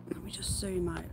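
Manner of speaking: softly whispered aside. Real, not acted. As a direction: whisper so the animals aren't disturbed. Quiet aside while filming.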